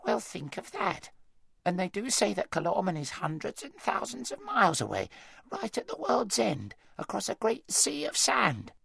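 a marvelling country hedgehog, unhurried; wheezy hedgehog. A narrator's reading. Hedgehog voice, high, slow.

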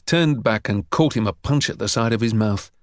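ordinary delivery

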